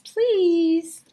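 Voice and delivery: high, loud "please"; squeaky please. Acted. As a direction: squeak out the answer.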